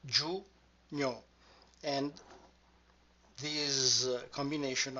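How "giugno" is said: "giugno" as giu, pause, gno; two separate clusters. Shown as a demonstration. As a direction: split "giugno" softly into its two sounds. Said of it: "contains two of those clusters".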